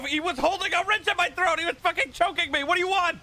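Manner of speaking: high, loud, laughing outrage; outraged shouting. Real, not acted. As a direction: yell it in disbelief. Outraged yelling at attacker.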